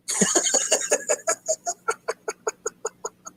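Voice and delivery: airy, wheezing laugh; long high giggle. Real, not acted. To his viewers after the orange joke, a breathless giggle.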